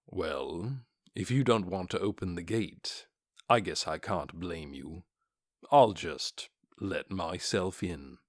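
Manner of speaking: low, calm dark warrior voice; cool, unbothered armored warrior; Momon, low and cool. Acted.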